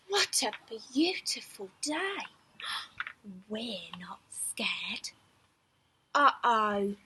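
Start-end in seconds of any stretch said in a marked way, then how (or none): 2.6-3.4 sharp startled gasp. A narrator's reading. Reading bear hunt aloud. 3.5-5.1 slow breathy whisper, pretending to be brave. A narrator's reading. Refrain whispered in storytelling.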